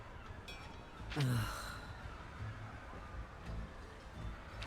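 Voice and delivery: a long, soft, weary sigh; deep sigh, gathering herself. Acted. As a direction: let out a tired breath before the speech. Captain sighs before addressing crew.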